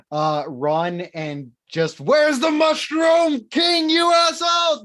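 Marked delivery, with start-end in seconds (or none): 2.0-4.8 loud, high, wild yell; shouting. Retold or imitated. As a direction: yell it like you're high on spores. Retold charge, spore-crazed.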